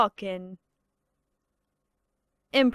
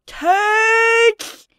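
high shrieking yell of Turds!; shrieked insult; 10 semitones higher, 8 dB louder than usual. Retold or imitated. Cartoon brat screaming at the kids, shrill shriek. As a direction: screech it out in a cartoon fury. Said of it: "some of these impressions".

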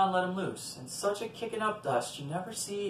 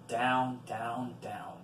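each word lower and softer; descending; 5 semitones lower, 5 dB quieter, much slower than usual. A narrator's reading. Storyteller following John down the staircase, descending voice. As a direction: sink step by step, fading away.

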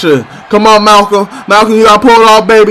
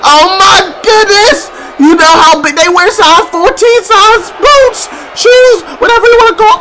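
high, shouted disbelief; excited shouting; 9 semitones higher than usual. Real, not acted. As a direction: yell it, blown away. Shouting about huge boots.

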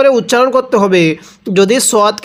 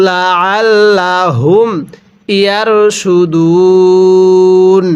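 drawn-out tajweed chant with a lengthened ending; slow Quran recitation; slower than usual. Shown as a demonstration. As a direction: recite slowly, stretching the final word. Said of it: "পড়ুন আমার সাথে".

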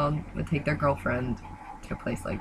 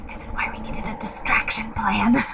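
breathy secret whisper, pitched up; whispered aside; 6 semitones higher than usual. Retold or imitated. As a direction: hush it like a plotter sharing a plan. Liz quietly plotting with friends.

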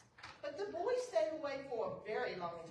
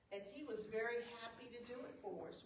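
plain reading voice

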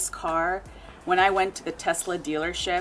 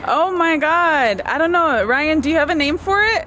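excited; 8 semitones higher, 6 dB louder than usual. Real, not acted. Thrilled at dealership.

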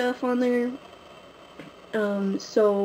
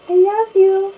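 cooing to the cat; 11 semitones higher, 9 dB louder, slower than usual. Real, not acted. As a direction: squeal it up high at the cat. Talking sweetly to her cat.

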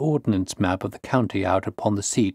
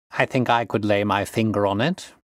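ordinary delivery, nothing marked

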